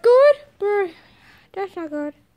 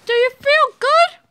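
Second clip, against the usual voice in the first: excited; 6 semitones higher than usual. Acted. Healed patient answers cheerfully.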